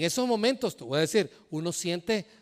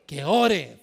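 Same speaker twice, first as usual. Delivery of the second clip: emphatic, louder answer; firme; 3 semitones lower, 5 dB louder than usual. Acted. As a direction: land the answer hard. Pastor answers scripture prescription. ("Que ore" dicho con énfasis y más fuerte)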